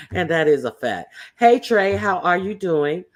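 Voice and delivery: ordinary talk, nothing marked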